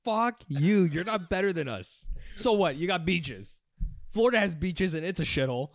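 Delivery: loud, high mock-angry rant; mock outrage. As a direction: yell it like you're personally offended.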